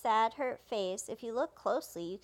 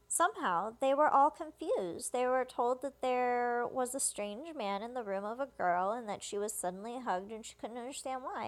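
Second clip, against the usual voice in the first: normal talking voice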